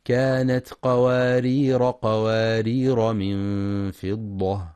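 قوارير run on without a final a; alif dropped in continuous reading. Shown as a demonstration. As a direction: read قوارير straight into the next word. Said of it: "وحذفها وصلا هكذا".

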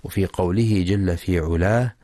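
normal talking voice

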